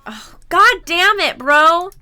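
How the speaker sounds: irritated cry of frustration; exasperated outburst. Real, not acted. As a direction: blurt it out, annoyed. Annoyed by ad playing.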